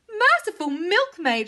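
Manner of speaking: high aggrieved outcry; high cow exclamation; bellowing cow. A narrator's reading. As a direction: cry out as a suffering cow. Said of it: "she bellowed".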